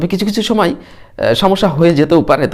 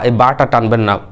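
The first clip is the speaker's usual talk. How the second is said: normal talking voice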